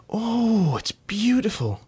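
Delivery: delighted gasp. A narrator's reading. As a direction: swoon over the gift.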